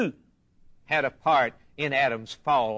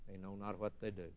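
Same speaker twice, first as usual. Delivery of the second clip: much softer, lower plea; hushed prayer; 6 semitones lower, 18 dB quieter, faster than usual. Acted. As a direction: let it drop to a quick, low murmur. Voicing Jesus's prayer on the cross.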